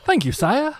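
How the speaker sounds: a mock-courtly British servant thanking his lord; posh British thanks. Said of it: "that posh British accent that Stu was doing".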